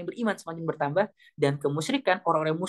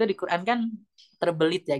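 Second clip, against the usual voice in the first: ordinary delivery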